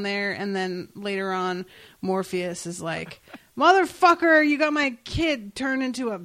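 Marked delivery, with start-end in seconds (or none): 3.6-6.2 high, loud opening in mock outrage; hammed-up scolding; mock angry outburst. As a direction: play up the indignant accusation. Retelling an angry Morpheus line.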